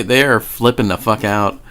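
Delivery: ordinary talk, nothing marked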